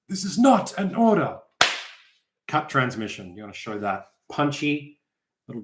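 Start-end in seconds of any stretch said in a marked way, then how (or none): 0.1-1.4 dramatic repeat. Acted. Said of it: "kind of a comedy moment".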